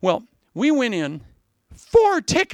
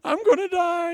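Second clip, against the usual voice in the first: mock dying kid; 12 semitones higher than usual. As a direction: play a boy with a stomachache. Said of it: "I said to my brother".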